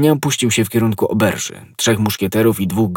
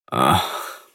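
low, faint dying moan; dying cry; 6 semitones lower, 5 dB quieter than usual. A narrator's reading. Dying soldier on seeing his suspected poisoner, weak cry. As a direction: let out a weak, pained "ach". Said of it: "zawołał, ujrzawszy D'Artagnana".

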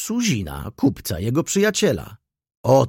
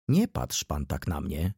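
calm voice; 7 semitones lower, 6 dB quieter than usual. A narrator's reading. Doctor to the frightened Rzecki, calm and soft. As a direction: lower and softer, calm and unhurried. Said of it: "ciągnął spokojnym głosem Szuman".

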